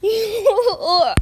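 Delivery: loud laughing yelps of pain; loud mock ow; pained shout. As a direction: yelp out as if hurt. Play ends in pained shouts.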